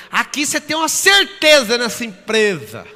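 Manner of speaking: loud, high, put-on coworker voice; loud, high mock of a colleague. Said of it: "Aí o outro".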